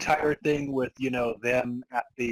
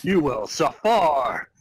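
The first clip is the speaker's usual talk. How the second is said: slow, loud, higher menacing threat; hammy villain threat; 4 semitones higher, 8 dB louder, slower than usual. Acted. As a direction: snarl it out like a cartoon villain. Reading villain line dramatically.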